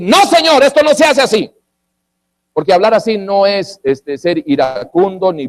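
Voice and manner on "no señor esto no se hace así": high, loud indignant rebuke; demonstrating an irate, forceful objection; angry firmness. Shown as a demonstration. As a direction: raise the voice sharply and lay down the law. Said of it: "usted inclusive se puede airar y decir".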